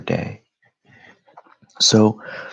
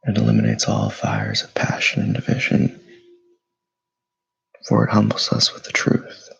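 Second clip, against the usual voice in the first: guided meditation; 5 dB louder than usual. Acted. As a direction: lead them through stillness, unhurried and steady. Leads a morning meditation.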